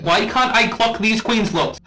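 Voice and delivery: high, loud exasperated cry; exasperated outburst. Real, not acted. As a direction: throw up your hands in frustration. Frustrated outburst judging drag looks.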